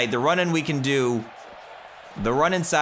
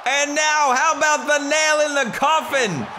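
hype commentator; 12 semitones higher than usual. Pretend. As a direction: call the match like a ringside announcer. Mock wrestling commentary.

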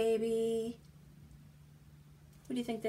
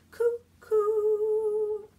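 high loud cuckoo-bird call; 12 semitones higher, 8 dB louder than usual. Acted. Cuckoo clock call in rhyme.

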